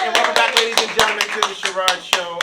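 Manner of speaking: big booming show host welcome; announcer voice. Acted.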